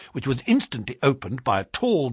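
usual speaking voice

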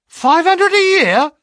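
very high, loud, slow shout of disbelief; astonished shout. A narrator's reading. Clerk hears the salary offer, shouting in astonishment. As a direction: shout it, stunned by the sum. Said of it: "I shouted".

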